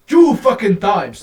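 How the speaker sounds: loud, slow and furious; angry slow shout. Real, not acted. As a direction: yell it out, slowly, furious at him. Angry rant at YouTuber.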